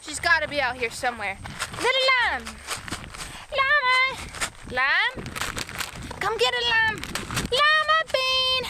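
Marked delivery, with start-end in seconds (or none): 1.8-5.2 calling out. Real, not acted. Calling out for lost pet. 6.2-8.6 high, carrying call for a lost pet. Real, not acted.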